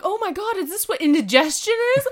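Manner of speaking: high, loud, thrilled character voice; excited Michael voice. Retold or imitated. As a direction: squeal it like a thrilled demon. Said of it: "He'll be like".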